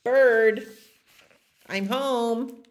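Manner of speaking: high, singled-out call announcing arrival. Acted. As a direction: call through the house to a friend. Dog calls out on return.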